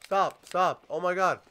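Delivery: usual speaking voice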